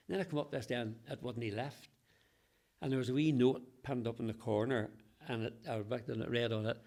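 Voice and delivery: ordinary talk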